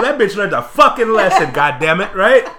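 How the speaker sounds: loud, high, laughing gloat; loud laughing boast to his coworker; gleeful gloating. Retold or imitated. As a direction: crow over her comeuppance. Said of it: "I go".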